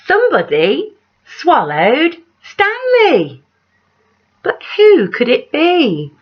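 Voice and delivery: lively read-aloud with big rises on the refrain; story narration. A narrator's reading. Reading a picture book aloud to children, animated storyteller voice. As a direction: tell it like a bedtime picture book.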